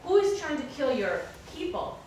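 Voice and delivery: loud, high, outraged demand; explosive anger. Retold or imitated. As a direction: erupt as an enraged king. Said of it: "His anger is just explosive".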